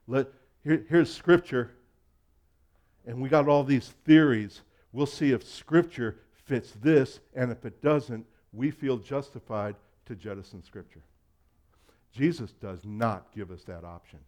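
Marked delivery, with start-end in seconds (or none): 0.1-11.0 louder, scoffing take on the critics; mock their confident dismissal of Scripture; mocking quote. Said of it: "Instead of saying".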